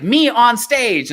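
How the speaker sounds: high, drawn-out showman's flourish; big stretched-out performer voice; showy stage persona. Retold or imitated. Retelling a meme of the on-stage self, showy and high. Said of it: "it's him as [name]".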